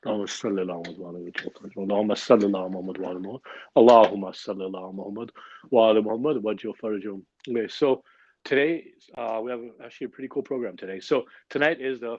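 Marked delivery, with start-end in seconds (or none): 0.1-7.2 fast run-together Arabic blessing; rapid prayer recitation. Real, not acted. Host recites the blessings quickly with the gathering. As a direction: rattle through the salawat quickly.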